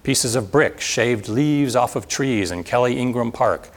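ordinary delivery

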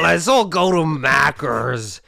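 slow, exaggerated American "Maccas"; mock American accent. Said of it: "That's my American accent".

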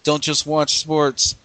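slow, punchy tagline read; slogan read. Acted. Co-host closes the show with the slogan as a slow ad read. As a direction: sell the slogan slowly like an ad. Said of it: "slogan delivery".

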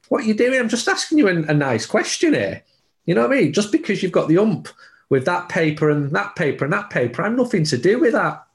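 high-pitched, aggrieved mock retort; indignant protest. Reporter silently answering a scowling coach, indignant and high-pitched. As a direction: protest your innocence, voice pitched well up. Imagined comeback to scowling interviewee.

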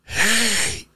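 high-pitched retold "hey" yell, held back in volume. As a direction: call out high, imitating your yell. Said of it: "and I yell".

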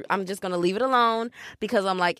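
ordinary talk, nothing marked

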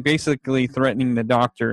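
ordinary delivery

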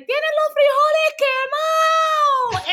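very high, loud, drawn-out mimicry; teasing mother. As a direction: crow it slowly and shrilly, imitating mom. Mimics laughing mother's teasing call.

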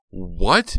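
loud, sharp, rising what; startled outcry at her uncle's accusation; shocked cry. A narrator's reading. Heather blurts it out in loud shock. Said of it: "Heather cried".

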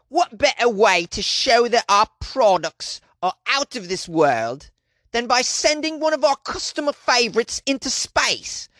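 mock British accent, voicing the company director; bad British accent. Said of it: "Was that the worst British accent in history".

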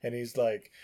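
ordinary delivery

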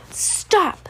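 high, sharp shout to stop a fight; baby sister stopping a quarrel, shrieking. Acted. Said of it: "Sonny shrieked".

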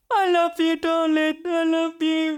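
put-on drunk-sounding slur. New mum imitating her slurred words to her baby, woozy. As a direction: imitate yourself woozy from the anaesthetic. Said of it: "like I was drunk, I was slurring".

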